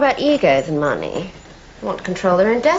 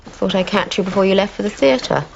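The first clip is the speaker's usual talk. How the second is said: normal talking voice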